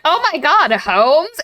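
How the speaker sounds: high, loud retold astonishment; mock shock. Watson discovers Holmes, retold.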